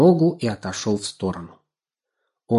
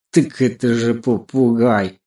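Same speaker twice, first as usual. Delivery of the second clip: louder, slow scornful cat; mocking snort; 5 dB louder, slower than usual. A narrator's reading. Cat dismissing the crow's story, mockingly. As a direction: scoff as if it were obvious. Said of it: "насмешливо фыркнул Мурзик".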